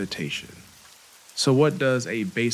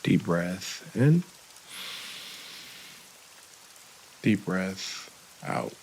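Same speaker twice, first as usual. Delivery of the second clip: guided meditation. Real, not acted. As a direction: lead the breathing unhurriedly, with long gaps.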